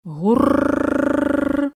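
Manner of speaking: long, high rolled-r engine noise; imitated engine trill. A narrator's reading. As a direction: trill the r like an approaching motor. Imitated distant tractor engine rumble.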